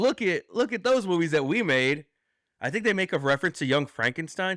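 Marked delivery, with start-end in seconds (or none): 0.0-2.1 mock boastful. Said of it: "to be like".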